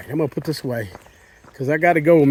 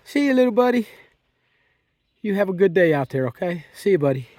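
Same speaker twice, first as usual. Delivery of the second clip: high gentle goodbye; talking to an animal; 4 semitones higher than usual. Real, not acted. Saying goodbye to the deer.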